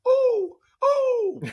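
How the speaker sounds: very high, laughing "ooh, ooh" squeal; excited squeal. Real, not acted. Excited about Hootsuite kickoff.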